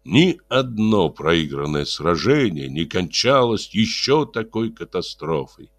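ordinary delivery

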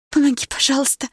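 high, urgent begging cry; pleading. A narrator's reading. Blonde begs the narrator for help, high pleading cry. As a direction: beg for help in panic. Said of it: "взмолилась она".

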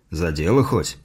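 low-pitched father's question, lightly grunted; grunting father. A narrator's reading. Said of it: "хмыкнул отец". (низкий голос отца, с лёгким хмыканьем)

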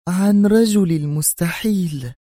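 high, drawn-out emphatic finish; exasperated. A narrator's reading. She names what they abandoned, vexed, slow and high. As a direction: stretch the words slowly in high-pitched vexation. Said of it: "تردف في حنق".

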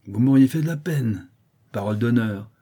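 sniggering mockery, acted lightly; sneering. A narrator's reading. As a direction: jeer while swearing you mean it. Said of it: "en ricanant".

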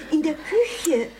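ordinary talk, nothing marked

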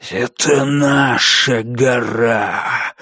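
slow, breathed-out ghostly threat; breathy ghost voice. A narrator's reading. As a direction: breathe it slowly into her ear. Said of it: "выдохнул кто-то прямо ей в ухо".

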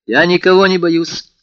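high, loud, slow irritated denial. A narrator's reading. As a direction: insist with irritation in the voice. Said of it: "с каким-то раздражением в голосе". (с раздражением, громко и высоко)

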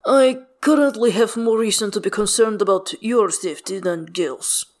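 lightly voiced careful bodyguard; a hint of a formal, guarded figure; measured, cautious character.

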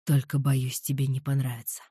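low, smirking man's voice. A narrator's reading. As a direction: add it with a smirk. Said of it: "с усмешкой добавил".

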